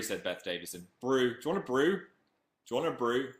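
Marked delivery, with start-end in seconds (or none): 1.0-1.3 brew said the Northern way. Shown as a demonstration. Fan asks him to say brew, Northern. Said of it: "Say brew". 1.3-3.3 Northern accent. Shown as a demonstration. Fan asks for brew, Northern offer. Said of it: "Say brew".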